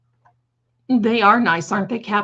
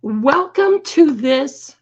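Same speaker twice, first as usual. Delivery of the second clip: grand announcer welcome; 6 semitones higher, 6 dB louder, slower than usual. Acted. Grand drawn-out host welcome.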